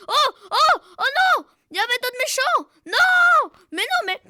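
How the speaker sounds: very high, loud cries of dismay; frantic high yelling; panicked shouting. Real, not acted. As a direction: scream in alarm at an ambush. More enemies suddenly appear.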